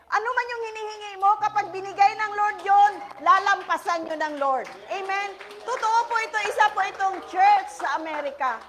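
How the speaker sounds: loud, high preaching burst. Acted. Pastor preaches to congregation at full force. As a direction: preach it out to the crowd.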